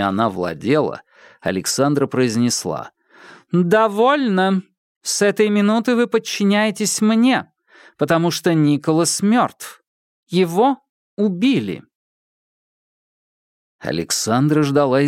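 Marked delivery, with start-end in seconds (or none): 3.5-11.8 commanding. Acted. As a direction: speak with full authority. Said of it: "Вложив в голос всю властность".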